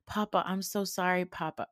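ordinary talk

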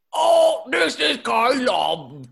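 put-on loud, high crying English accent; shouted English accent.

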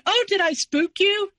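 high, slow teasing mock sing-song. Mother-in-law teasing the husband she just woke, high and slow. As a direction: tease him like it was a joke. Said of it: "she started laughing".

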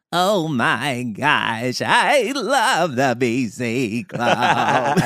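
put-on very slow, wavering old lady. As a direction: drag it out like a sweet granny. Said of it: "Give me an old lady".

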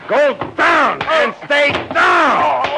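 slow, high, loud shout with the final punch; fighting shout. Acted. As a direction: roar it as you knock him down.

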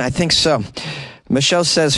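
ordinary talk

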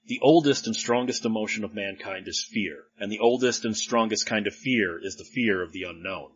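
low, soft recital of a quote; solemn quotation. Acted. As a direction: deliver it quietly and gravely, like a famous line. Recites Lovecraft's famous quote.

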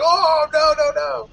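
high, loud mock protest; hammed-up high-pitched "whoa, no"; retold alarmed backing-off. Voices crowd backing down from fight.

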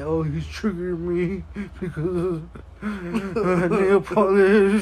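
put-on laugh-crying parody of an offended kid; mock crying complainer. Mocking kids who complain about nail polish, fake crying. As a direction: fake sobbing whine, slowed down. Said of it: "They're gonna be like".

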